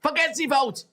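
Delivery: furious mock shout. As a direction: shout it like a furious dictator slamming the table. Playing Hitler shouting furiously.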